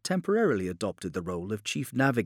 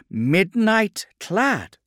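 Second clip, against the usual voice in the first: loud, high, drawn-out snarling question; snarled; 12 semitones higher, 9 dB louder, much slower than usual. A narrator's reading. Redloss recognising the puppet's phrase, snarling. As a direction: snarl the phrase back very slowly. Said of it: "snarled Redloss".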